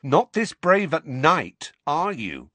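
high, loud, drawn-out sneering taunt. A narrator's reading. Bully taunting his cousin about night-time, sneering. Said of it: "sneered Dudley".

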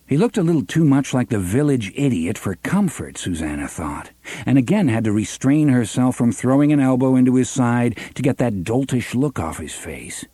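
plain reading voice

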